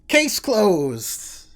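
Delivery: loud, gleeful case-closed cry; proud detective declaring victory; triumphant shout. Acted. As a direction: slam the case shut with glee.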